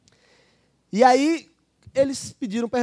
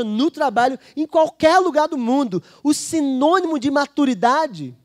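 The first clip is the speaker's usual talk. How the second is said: rising, louder sermon climax; preaching at full force; 4 semitones higher, 5 dB louder than usual. Acted. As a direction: press the point home to the church. (pregação intensa e mais alta)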